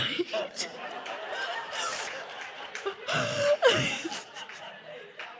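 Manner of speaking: long high nervous giggle; nervous laugh. Real, not acted. Nervous laughter mid testimony.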